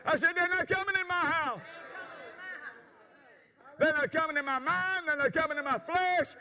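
full-force preaching. Acted. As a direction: preach it out to the congregation.

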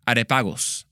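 Areopagos said with a Spanish accent, open vowels and rolled r. Shown as a demonstration. Trying the Greek name out with a Spanish accent. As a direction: say Areopagos the Spanish way, each syllable clear. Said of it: "with a s-, Mexican accent".

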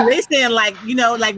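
normal talking voice

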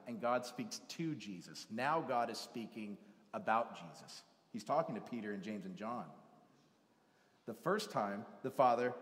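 normal talking voice

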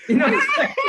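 high laughing question. Real, not acted.

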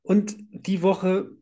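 ordinary talk, nothing marked

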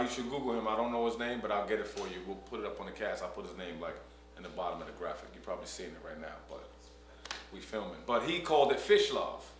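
ordinary talk, nothing marked

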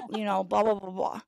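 usual speaking voice

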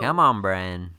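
loud playful protest; mock complaint. Complaining about husband's terrible gifts, mock protest. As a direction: call it out loudly in mock reproach.

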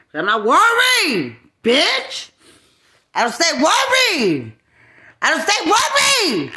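loud, high shouts rising to a scream; defiant shouting. Real, not acted. Daring someone to say their name, shouting angrily. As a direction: yell the challenge, pitch climbing sharply.

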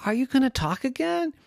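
high-pitched questioning voice; coaxing question. Acted. Everyone asking silent Yvette to speak, high coaxing question. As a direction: ask it up high with a lift.

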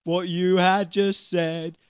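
slow, loud, chanting character voice; drawn-out silly chimney-sweep; Bert. Acted. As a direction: stretch each word out hammily. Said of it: "My name is Bert".

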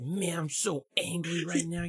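badly faked anime anger; nineties anime sibling. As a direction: play a corny dubbed kid being cross. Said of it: "perfect nineties anime sibling talk".

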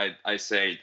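ordinary talk, nothing marked

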